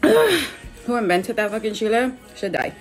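slow, strained, in pain from heat; mouth burning from spicy food. Real, not acted. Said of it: "My ears are fucking killing me".

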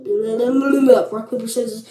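ordinary talk

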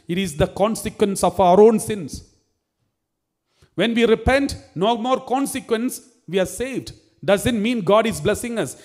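fiery preacher pressing his point; full-force preaching. Acted. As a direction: preach it out to the congregation.

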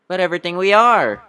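rousing courtroom appeal; impassioned plea. Acted. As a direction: lift it high and loud to finish. Climax of bee's courtroom speech.

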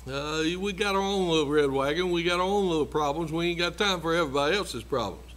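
ordinary talk

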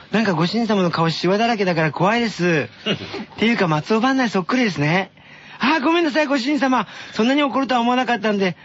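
high sweet maid voice; sugary maid cafe greeting. Acted. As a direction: play a cutesy cafe maid. Maid cafe skit read by S0. (甘いメイド喫茶の声)

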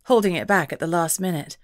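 ordinary delivery

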